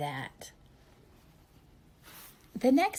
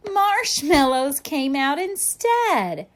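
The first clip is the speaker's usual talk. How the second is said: gasp, then slow louder reveal; surprised reveal; 5 semitones higher, 9 dB louder, slower than usual. A narrator's reading.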